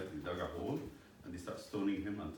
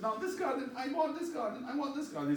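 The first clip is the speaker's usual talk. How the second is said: put-on very high childish whine; king insisting to his wife, high childish whine; whining king. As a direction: stamp your foot like a baby.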